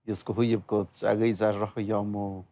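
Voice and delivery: an earnest greeting spoken in his native Sierra Norte language; in his mother tongue. Real, not acted. Guest thanks the host in his mother tongue, an earnest native-language greeting. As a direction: thank the host warmly in your own tongue. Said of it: "te acabo de dar las gracias en mi lengua materna".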